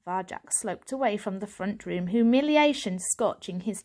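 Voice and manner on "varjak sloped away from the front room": usual speaking voice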